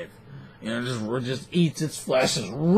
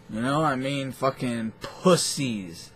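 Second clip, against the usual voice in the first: ordinary talk, nothing marked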